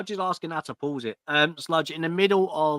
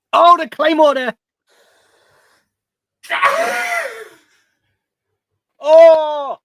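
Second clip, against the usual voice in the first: excited shout; 14 semitones higher, 16 dB louder, slower than usual. Real, not acted. Reacting to big wrestling move.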